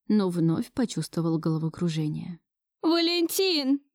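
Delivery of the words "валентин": high, drawn-out call without much volume; slow, higher-pitched mock shout for help. A narrator's reading. Grandmother running to the door to call Valentin, calling out. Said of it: "закричала бабушка".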